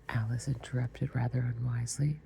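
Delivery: ordinary delivery, nothing marked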